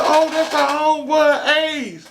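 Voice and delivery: man imitating the women brightly introducing Ace; girls' voice. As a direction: mimic the girls gushing over their friend. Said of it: "they'll be like".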